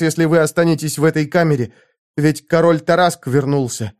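a concubine baring her soul; earnest young woman. A narrator's reading. Zenobia warns Conan.

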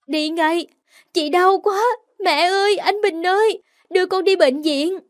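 high, loud desperate calling; high, anguished pleading cries; crying out in pain. Acted. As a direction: cry out for mother and brother.

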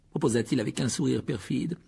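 usual speaking voice

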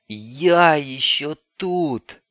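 slow, gurgled cry through water; gurgling. Acted. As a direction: call out half underwater. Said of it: "пробулькал кто-то из клетки". (булькающим голосом)

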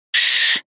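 sharp unvoiced shushing; an urgent "Ш-ш-ш" hiss; hushing hiss. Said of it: "прошипела она".

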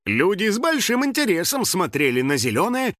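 ordinary delivery

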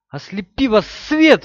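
high, furious battle cry; furious shout. A narrator's reading. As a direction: hurl the curse as you strike. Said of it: "выкрикнула она".

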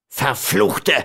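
furious curse. A narrator's reading. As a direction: hurl the curse in bitter anger. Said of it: "schrie er in bösem Zorn".

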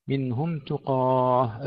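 "تقاه" slow with heavy qaaf and alif; tajweed demo. Shown as a demonstration. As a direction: stretch "تقاه" full and heavy. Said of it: "تفخيم القاف والألف".

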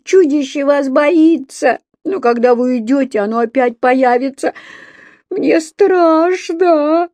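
put-on high, whining, crying child; tearful wail. A narrator's reading. Еля begging her parents not to go, tearful wail. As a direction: plead tearfully, stretching the last words into a wail. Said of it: "Опять заплакала Еля".

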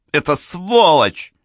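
high, drawn-out outraged cry; angry cry. A narrator's reading. Mrs. Amberley curses the man who cheated her, crying out angrily. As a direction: cry it out high and slow, quivering with anger. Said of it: "крикнула она голосом, дрожащим от гнева".

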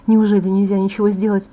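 plain reading voice

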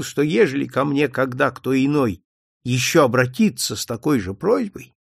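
ordinary delivery, nothing marked

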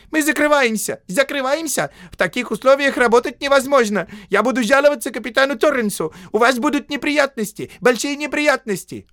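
very high, loud frantic shouting; panicked clerk shrieking threats; истеричный крик. A narrator's reading. (истеричный писарь, очень высокий крик)

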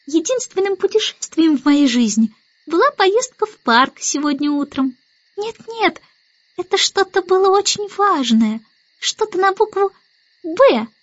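put-on high little-girl voice; fretful baby Annabel. A narrator's reading. Baby Annabel hunts for the forgotten word, high and fretful. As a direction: think aloud high, like a small child.

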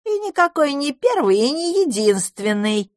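very high-pitched bat voice; scornful bat. A narrator's reading. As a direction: contradict in a high, mocking little voice. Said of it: "сказала другая". (высокий голос вредной летучей мыши)

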